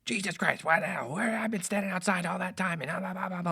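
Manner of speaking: high-pitched mock screaming tirade. Host bursts into the control room, screaming at producer. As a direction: imitate an irate man ranting on and on. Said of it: "screaming at me".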